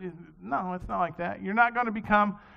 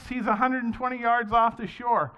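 usual speaking voice

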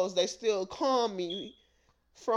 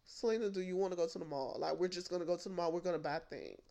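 a light cousin voice, lower and softer; breezy invite, lower and softer; cousin's voice; 5 semitones lower, 10 dB quieter, faster than usual. Retold or imitated. Cousin casually inviting her to the mall, lower and soft. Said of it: "My cousin would just be like".

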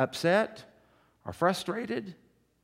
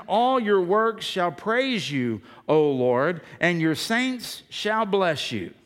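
scripture reading; 3 semitones higher, 4 dB louder, much slower than usual. Real, not acted.

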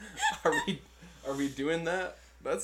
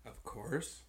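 voice cracking; 6 dB quieter than usual. Real, not acted. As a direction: voice cracks on the words. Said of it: "your voice cracked".

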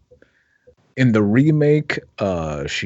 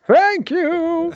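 mock delighted thanks; 3 dB louder than usual. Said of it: "you tried it and said".

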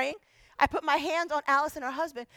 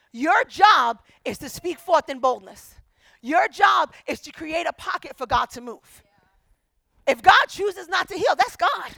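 loud, raised, forceful declaration; emphatic preaching; 4 semitones higher, 9 dB louder than usual. Real, not acted. Preacher telling church what their job is, emphatic and loud. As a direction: drive each phrase home to the congregation.

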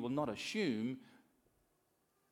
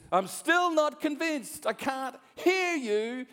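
slow, high, loud shout; drawn-out coach's yell; coach bellowing; 13 semitones higher, 10 dB louder, slower than usual. Acted. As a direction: push the team with every stretched word. Coach demanding louder answer.

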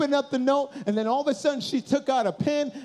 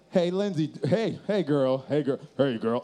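low, overly cool pickup voice; trying too hard to sound cool; rehearsed smooth greeting; 7 semitones lower than usual. Acted. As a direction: practise a laid-back, low-pitched hello.

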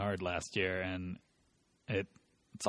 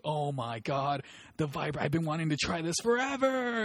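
high, loud gushing; excited kid; 8 semitones higher, 5 dB louder than usual. Retold or imitated. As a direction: imitate a teenager bursting with excitement. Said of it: "I'm so excited".

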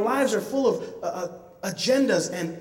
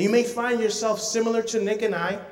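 ordinary delivery

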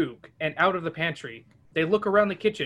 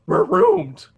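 Scooby-Doo whimper; 11 semitones higher, 9 dB louder than usual. Acted. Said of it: "Scooby whimpers".

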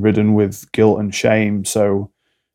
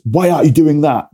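sharp, higher, accusing parent voice; judgmental parent; 5 semitones higher, 3 dB louder than usual. Retold or imitated. Demoing a parent scolding a child, accusing and higher.

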